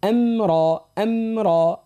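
chanted أمرا with a long, full را; correct heavy R. Shown as a demonstration. Teacher modelling for the girl, drawn-out heavy أمرا. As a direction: hold أمرا slowly on a thick R. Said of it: "مش أما".

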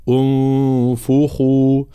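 "انفخوا" begun on "u", nasal held; starting with damma. Shown as a demonstration. Teacher showing how to begin, "انفخوا" with initial u. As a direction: open "انفخوا" as a slow "unfukhu". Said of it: "نبتدئ بهمزة وصل مضمومة".

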